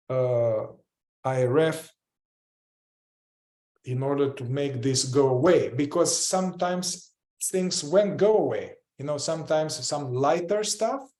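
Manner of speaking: normal talking voice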